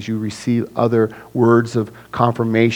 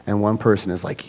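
normal talking voice

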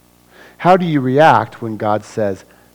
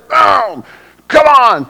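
much higher, louder cry of frustration; frustrated outburst; 13 semitones higher, 7 dB louder, slower than usual. Acted. Preacher acting out a crash, frustrated yell. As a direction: blurt it like a driver hitting the rail.

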